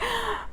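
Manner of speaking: high astonished gasp; mother's gasp at first sight of baby; sharp gasp. Retold or imitated. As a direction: gasp in wonder. Seeing newborn.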